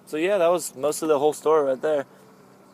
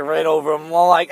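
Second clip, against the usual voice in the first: ordinary delivery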